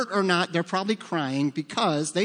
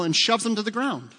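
ordinary talk, nothing marked

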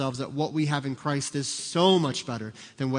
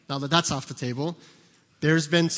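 usual speaking voice

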